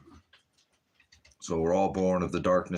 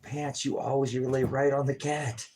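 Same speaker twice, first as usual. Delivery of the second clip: exasperated scold; 8 semitones higher than usual. Real, not acted. Owner scolding Pants for lying on the cat, high exasperated. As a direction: scold the dog with fed-up disbelief.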